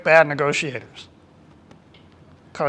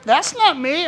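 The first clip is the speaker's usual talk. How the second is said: indignant denial; 11 semitones higher, 4 dB louder than usual. Retold or imitated. Michael denying he is orange.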